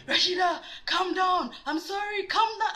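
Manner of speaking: high, frantic imitation of boyfriend; mock-terrified begging from the passenger seat; panicked pleading. Acts out scared boyfriend gripping seatbelt.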